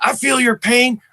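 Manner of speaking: high, Eastwood-like rasp; Joe Biden. Acted. Comedian as a sympathetic president, high raspy impression. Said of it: "sort of Clint Eastwood raspy".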